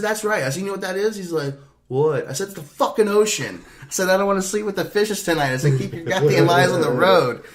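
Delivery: high, loud telling-off; loud high angry warning; scolding. Retold or imitated. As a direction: chew him out to stay awake. Scolding sleepy driver.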